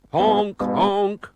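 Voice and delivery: loud, slow honking; car horn imitation. A narrator's reading. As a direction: honk like an old car horn. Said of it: "went another horn".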